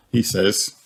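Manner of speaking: usual speaking voice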